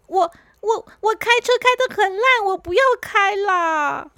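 high stammering whine, dragging the end; girl refusing to drive, mild whine; reluctant girl. Acted. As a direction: whine that you don't want to drive.

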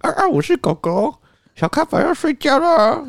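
very high, slow cartoon puppy. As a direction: play a cute puppet dog. 父亲扮狗狗说话. (高音慢速扮可爱小狗)

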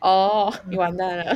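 ordinary talk, nothing marked

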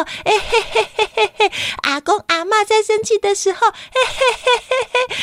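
giggly high voice breaking into laughs; child giggling as she demonstrates; laughing. Acted. Meixiang laughing demo for each adult.

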